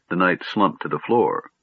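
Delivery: usual speaking voice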